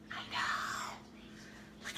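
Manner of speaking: unvoiced hushed aside; whisper. Real, not acted. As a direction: breathe it as a secret aside. Aside while showing old pants.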